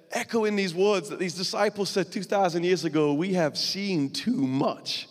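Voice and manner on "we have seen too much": slow emphatic echo. Acted. Echoing the disciples, slowed right down.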